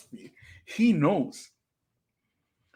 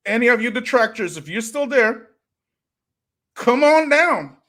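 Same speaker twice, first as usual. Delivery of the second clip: higher, louder challenge to join; taunting call; 7 semitones higher, 6 dB louder than usual. Real, not acted. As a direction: call out the critics like a game-show host. Taunting detractors to join live.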